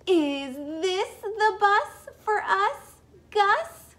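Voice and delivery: bouncing with excitement at the bus stop; excited asking. Acted. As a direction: ask it like the bus is finally here. Excited child character asks.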